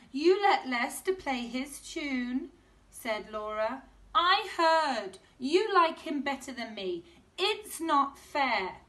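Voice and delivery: put-on high, loud, sulky child complaining; loud jealous kid's whine; sulky girl. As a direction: whine that it's unfair.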